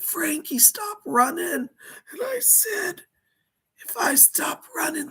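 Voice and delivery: high whispered panic, breaking into cries; pleading in terror; frightened, near tears. Pretend. Terrified retelling of being chased.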